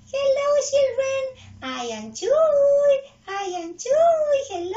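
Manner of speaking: high, loud puppet-like squeal; slow, high-pitched cartoon helper voice; Chuy. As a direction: greet children as an excitable little character, vowels stretched. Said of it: "quieren ayudarme en la presentación".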